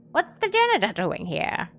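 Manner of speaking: stern demand. Acted. Old man demanding of the stranger, loud and stern. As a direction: call out the intruder sharply. Old headmaster confronts intruder.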